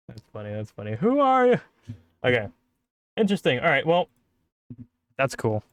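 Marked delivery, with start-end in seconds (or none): none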